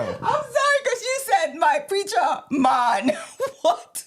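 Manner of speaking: high, helpless laughing speech; laughing to tears. Real, not acted. Co-host fallen out of chair over the rhyme, laughing to tears. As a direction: squeal it through uncontrollable laughter. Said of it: "I'm literally tearing up".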